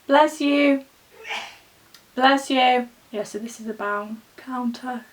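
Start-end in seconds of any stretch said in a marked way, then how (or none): 0.1-0.8 called out. Real, not acted. Calling bless you after sneeze. 2.2-2.9 called out. Real, not acted. Calling bless you after sneeze.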